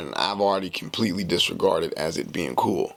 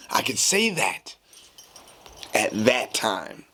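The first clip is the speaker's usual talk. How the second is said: ordinary talk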